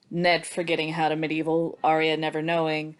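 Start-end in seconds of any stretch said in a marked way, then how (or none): none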